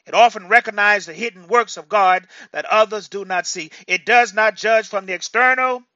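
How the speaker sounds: ringing out loud and high to the congregation; preaching at full force. Real, not acted. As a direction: proclaim it with fire. Preacher building to climax.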